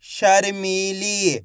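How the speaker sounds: शर्मीली very slow, long ee vowels; blended word demo. Shown as a demonstration. Teacher showing the joined word again, शर्मीली stretched slowly. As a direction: draw out each syllable of शर्मीली. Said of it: "तो मिलके बन जाएगा".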